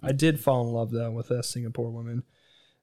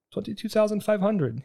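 higher mock shopkeeper; 8 semitones higher than usual. The watch seller pricing a Casio, higher. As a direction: quote the price grandly. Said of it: "The guy goes".

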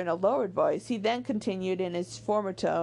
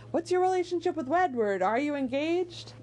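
higher-pitched nosy teasing imitation; prodding questions; 6 semitones higher than usual. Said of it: "prodded Eleanor at the beginning of the book".

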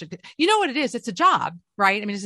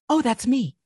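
sudden realisation; 6 semitones higher, 4 dB louder, slower than usual. Retold or imitated.

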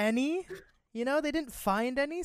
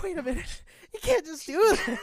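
higher, indignant objection; mock protest; 7 semitones higher, 5 dB louder than usual. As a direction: protest in disbelief. Retold protest of students.

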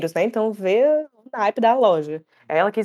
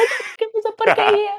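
much higher mock wail; imitating Vera repeating her apology; squealing foreign woman; 14 semitones higher than usual. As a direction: overdo the dismay. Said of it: "E ela".

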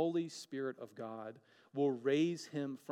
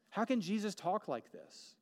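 rising wonder; 7 semitones higher than usual. Real, not acted. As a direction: build the question up in awe.

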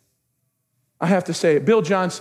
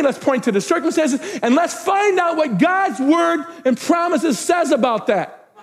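high, ringing proclamation; full-force preaching; 8 semitones higher than usual. Real, not acted. Pastor proclaiming he will shine the light, full-force preaching. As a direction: proclaim it with mounting fervour.

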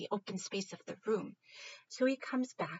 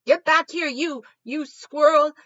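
very loud, higher, slow angry dog yell; furious bark; 7 semitones higher, 15 dB louder than usual. Acted. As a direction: roar after the fleeing squirrel. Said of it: "he's just all".

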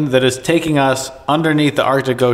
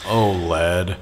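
Han Solo impression; 5 semitones lower than usual. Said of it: "that Han Solo kind of talking".

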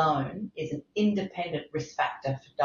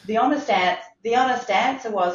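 higher and louder, laugh-filled start; 6 semitones higher, 6 dB louder than usual. Real, not acted. Speaker laughing as he admits his mistake. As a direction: push through the laugh. Laughing at own blind spot.

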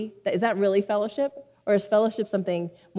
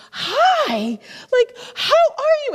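very high, loud imitated hello; exuberant greeting; 7 dB louder, slower than usual. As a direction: burst out overjoyed to see a favourite person. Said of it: "he greets me with this".

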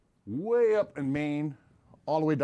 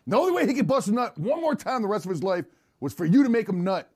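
loud, raised-pitch mock courtroom rant; hammed-up advocacy; 5 semitones higher, 7 dB louder than usual. Mock lawyer argues the homie's loyalty, hammed-up loud pleading. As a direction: drive the absurd argument home loudly.

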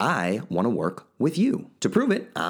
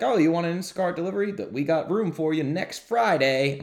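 fast, higher, lightly voiced cheery clerk; mock store clerk; 9 semitones higher than usual. Host mimicking the Instacart service, lightly voiced chirpy clerk. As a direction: rattle it off like a chirpy delivery app. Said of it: "It's like".

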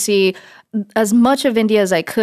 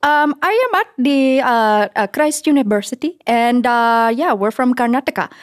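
put-on light Indian accent, higher; 3 semitones higher than usual. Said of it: "Wow, that was good".

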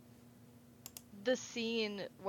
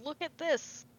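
higher-pitched mock excited villain; lightly hammed-up excited reveal; imitated excitement; 7 semitones higher than usual. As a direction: act out his eagerness to show it off. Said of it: "very excitedly".

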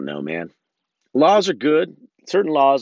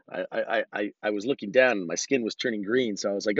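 normal talking voice

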